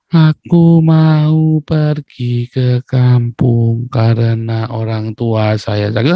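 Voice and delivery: very slow, drawn-out syllables, almost chanted; mock-gentle Javanese. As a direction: stretch every syllable into an exaggerated polite plea. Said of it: "itu baru lembut".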